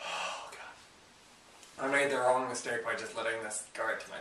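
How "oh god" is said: breathy pained whisper; whispered groan. Real, not acted. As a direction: groan it under your breath. Lingering stomach burn.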